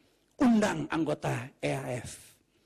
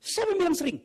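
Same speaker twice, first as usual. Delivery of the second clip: high, loud indignant retort; Gina angry; 5 dB louder, faster than usual. Retold or imitated. Wife challenging husband's choice of word, mock-angry. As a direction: mimic an offended wife firing back. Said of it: "Wah, Gina marah".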